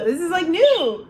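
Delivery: normal talking voice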